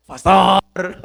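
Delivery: loud, drawn-out comic call; mock wake-up yell. Early riser waking his pastor, loud mock yell. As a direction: holler to rouse a sleeper.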